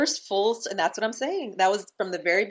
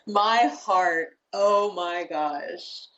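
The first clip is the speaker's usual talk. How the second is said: choked up; much slower than usual. Real, not acted. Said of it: "I wanna cry right now".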